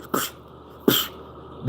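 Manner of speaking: soft breathy psh like a flash going off; camera flash noise. Retold or imitated. Describing flashes with each hit, soft unvoiced psh. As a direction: puff out the flash sound under the breath. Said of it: "a camera flash".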